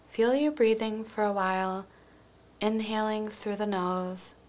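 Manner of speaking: quiet, even, soothing visualization read; guided meditation. Acted. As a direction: gently guide the listener into calm, leaving space between phrases.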